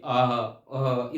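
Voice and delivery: put-on slow drawn-out happy baby coo aah aah; baby cooing sound. Shown as a demonstration. Teacher imitating a happy baby's coo for parents. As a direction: stretch each aah like a content infant. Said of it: "वो आवाज़ निकलती है".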